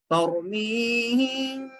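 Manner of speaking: slow tajweed chant with long pauses; Quran recitation. Shown as a demonstration. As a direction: hold the nasals and long vowels, phrase by phrase. Recites new verse, pausing.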